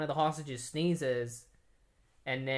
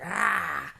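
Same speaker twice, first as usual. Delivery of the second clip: a short loud frustrated "Ah!"; frustrated yell; 5 semitones higher, 5 dB louder than usual. Retold or imitated. John wrecking his room, angry yell. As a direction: let out a burst of rage.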